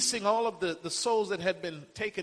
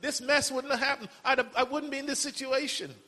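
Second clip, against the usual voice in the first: higher, imitating a complaining believer; mock whining at God, voice raised high; 6 semitones higher, 3 dB louder than usual. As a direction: act out a grumbling prayer, pitched up. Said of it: "that's how we talk".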